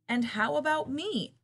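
high, loud indignant squirrel. A narrator's reading. Squirrel demanding notice from the others, mildly indignant. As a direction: pipe up indignantly, lightly acted. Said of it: "chattered the squirrel indignantly".

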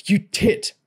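high yelled insult; shouting. A narrator's reading. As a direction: yell it across the mall. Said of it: "Junior shouted".

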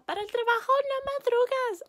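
high, loud scolding mom; high-pitched nagging mother. Retold or imitated. Mom voiced in story.

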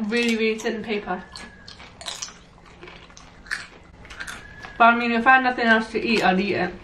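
speaking between bites; talking while chewing. Real, not acted. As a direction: mouth partly full of chip. Seen 2: Woman on left bites purple chip at 1.5s, chews while talking.

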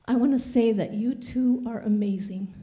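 shaky, emotional voice. Real, not acted. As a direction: voice shaking with emotion. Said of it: "my voice is shaking because".

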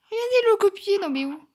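real indignant yell; indignant shout. Real, not acted. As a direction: shout it out in disbelief. Shouting at copycat players.

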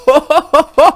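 high, loud mock Santa ho-ho-ho; booming pretend Santa chuckle; Santa laugh. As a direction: belt out a jolly hammed-up ho-ho. Jolly laugh opening the video.

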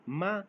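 माँ rising upward; rising माँ for hemp; Chinese second tone má. Shown as a demonstration. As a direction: lift माँ like a question. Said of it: "हम को बोलते हैं".

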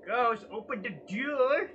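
loud high demand, rising; yelling. Real, not acted. Streamer yelling at the ghost to open. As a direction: order the ghost loudly.

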